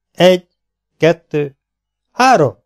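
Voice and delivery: loud count. A narrator's reading. Secretary claps the signal to shoot, counting loudly. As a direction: call each number out, peaking on the last.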